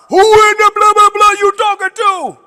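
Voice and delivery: high, loud, indignant shout; mock-furious yell back at a parent; angry comeback. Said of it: "respond how I want to respond".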